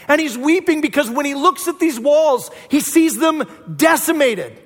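loud, high impassioned preaching; a preacher's rising passionate climax; full-force preaching. Acted. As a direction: drive it home to the congregation.

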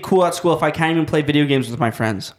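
ordinary talk

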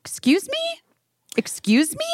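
high, outraged excuse me; indignant surprise. Real, not acted. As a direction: sound affronted and baffled. Indignant at computer.

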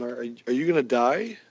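normal talking voice

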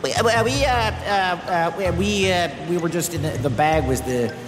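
stuttering explanation. A narrator's reading. Crew explaining to their captain, nervous stutter. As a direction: stumble nervously over every start. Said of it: "The crew stuttered as they tried to explain".

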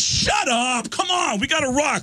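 high-pitched, hammed-up outburst; irritated shouting. Drummer shutting up his teasing bandmates, irritated shouting. Acted drummer brushing band off.

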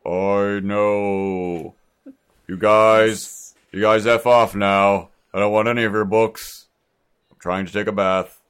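loud, irritated Jesus; annoyed Jesus voice. Acted. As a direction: shoo them off from the bath. Playing Jesus, annoyed in bath.

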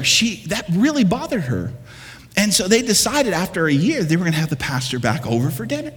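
ordinary talk

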